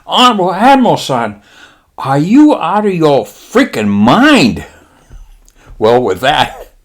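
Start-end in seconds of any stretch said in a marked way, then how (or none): 0.1-4.7 high mock-Japanese voice, slowing for the punchline; Japanese lieutenant. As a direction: draw out the outraged question. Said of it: "And the guy says".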